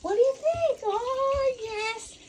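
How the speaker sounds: winding the dog up in a very high voice; excited dog talk. Real, not acted. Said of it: "See if I can get her worked up".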